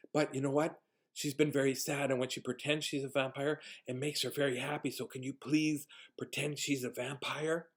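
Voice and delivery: put-on soft, confiding little-boy voice; imitated child whisper. Little boy asking visitor to play along, whispered. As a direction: keep it hushed, like a child's secret. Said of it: "whispers in my ear like this".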